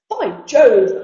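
loud, punchy acted exclamation; exclaiming cry. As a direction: burst out with an oath. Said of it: "cried the man".

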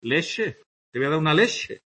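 leche with a soft sh, leshe; English-style ch. Shown as a demonstration. Teacher demonstrating Andalusian ch to class. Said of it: "se pronuncia a la inglesa".

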